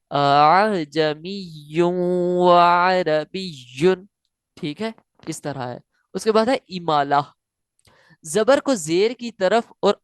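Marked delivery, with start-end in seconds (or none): 0.1-4.1 अअजमियुन व अरबियुन slow, voice waving on the softened hamza; tasheel recitation. Shown as a demonstration. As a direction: let the voice ripple between hamzas in अअजमियुन. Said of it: "कैसे?". (तसहील के साथ लहराती आवाज़ में)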